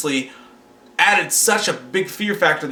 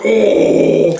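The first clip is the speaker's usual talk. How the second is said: loud, drawn-out villain howl; imitated scary voice; 6 dB louder than usual. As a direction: mimic the monster's long loud oh. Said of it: "it was scary 'cause his voice".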